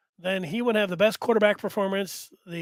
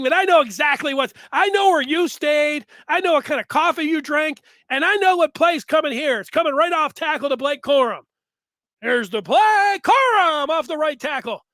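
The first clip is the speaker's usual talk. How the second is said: higher, louder mock broadcaster call; 1 mock Joel Klatt; 5 semitones higher, 5 dB louder than usual. Mocking the TV analyst who predicts every play, raised voice. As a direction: play the TV analyst calling every play before it happens. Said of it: "Joel Klatt's sitting up there and saying".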